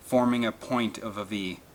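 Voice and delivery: plain reading voice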